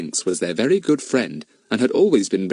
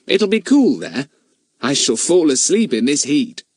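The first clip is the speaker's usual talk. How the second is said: put-on cheeky boy voice; 8 semitones higher, 3 dB louder than usual. Fatty speaks in the story.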